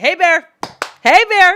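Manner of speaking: loud high call, hey bear; scaring off a bear. Pretend. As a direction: shout to scare off a bear. Shouting at imaginary bear.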